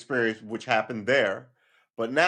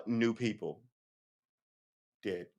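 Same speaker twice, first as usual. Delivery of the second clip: normal talking voice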